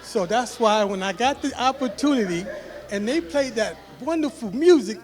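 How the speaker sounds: bubbling over with glee about dancing; excited laughing. Real, not acted. Father laughing to guests about getting down, excited and loud.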